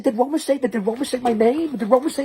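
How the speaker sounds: high, eager questions in a retold voice; excited. Friend texting to ask if Roma said his name, excited. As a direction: imitate a friend bursting with excitement. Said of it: "he was all excited".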